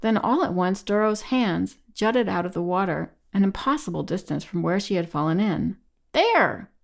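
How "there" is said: high-pitched cry, climbing on the repeat; urgent shout. A narrator's reading. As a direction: point it out in a panicked shout. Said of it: "Elaine shouted".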